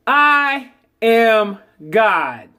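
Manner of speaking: very slow, each word set apart; slow affirmation. Shown as a demonstration. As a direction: lay it down slowly like a creed.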